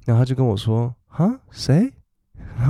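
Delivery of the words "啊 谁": high puzzled "who?" from the wife. Retold or imitated. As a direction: play the wife, lost and surprised. Wife confused by Kangxi. (妻子高声困惑地问)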